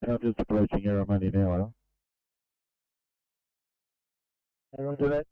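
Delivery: tinny two-way radio voice; crackly construction walkie talkie. Real, not acted. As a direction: speak through a crackling handheld radio.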